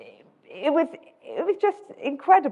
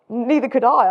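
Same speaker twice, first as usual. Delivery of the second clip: ordinary talk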